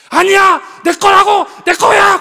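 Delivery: put-on high, loud child shouting over a toy; screaming child. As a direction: yell like a toddler grabbing a toy. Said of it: "그러고 싸워". (장난감 두고 싸우는 아이처럼 높고 크게 소리쳐)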